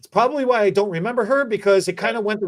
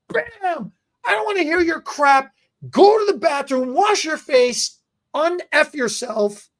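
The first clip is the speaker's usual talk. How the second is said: hammed-up uncle yelling, slower at the end; 9 semitones higher than usual. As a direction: snap orders like an angry uncle.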